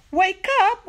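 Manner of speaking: ordinary delivery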